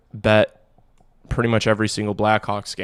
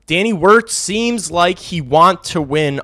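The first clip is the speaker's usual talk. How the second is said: emphatic; 7 semitones higher, 8 dB louder, slower than usual. Shown as a demonstration. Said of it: "repeats the line emphatically".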